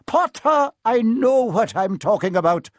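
high, loud, stern reproof; impatient professor pulling rank; short, cutting off. A narrator's reading. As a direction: shut a pupil down. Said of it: "she said shortly".

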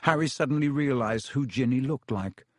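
ordinary delivery, nothing marked

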